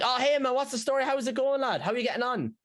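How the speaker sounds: high, bright mock-friendly hello to a fan; cheery Irish greeting. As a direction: greet him like an old mate, pitched high. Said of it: "And I'm like".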